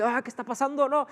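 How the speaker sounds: voz mucho más aguda, alarmada; despertar sobresaltado. Acted. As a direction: imitando a alguien que despierta de golpe, alterado. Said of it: "despertándose abruptamente". (sobresalto actuado al despertar abruptamente)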